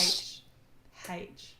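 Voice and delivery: H pronounced "haitch"; plain demonstration of H as "haitch"; Australian haitch. Shown as a demonstration. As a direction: say the letter H with a sounded h, "haitch". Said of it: "Say H again?".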